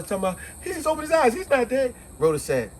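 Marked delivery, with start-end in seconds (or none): 0.6-1.9 high, frantic and panicked; hysterical retelling. Retold or imitated. Annette insisting to Rhoda the man lives, high panic. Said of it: "hysterical as Annette was".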